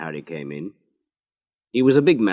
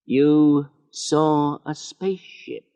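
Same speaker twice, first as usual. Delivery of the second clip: slow, higher, disbelieving; slowly; 8 semitones higher, slower than usual. Acted. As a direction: draw it out in disbelief. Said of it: "he said slowly".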